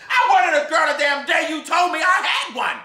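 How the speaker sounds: yelled high and loud; furious shout. Acted. Gus shouting at Emma Jean, furious. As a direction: Gus explodes at her.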